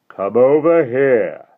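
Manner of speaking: slow, loud, high menacing call. Acted. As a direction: call them over, slow and commanding. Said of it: "the deep voice called to them again".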